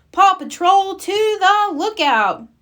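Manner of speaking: high, slow call to action; rallying call. Acted. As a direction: summon the team. Ryder's call.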